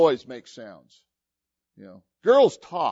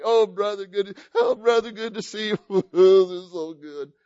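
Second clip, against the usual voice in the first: hammed-up blubbering, pitched up; mock happy crying; 5 semitones higher, 4 dB louder than usual. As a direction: imitate sobbing on a brother's neck. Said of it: "they're just crying on each other".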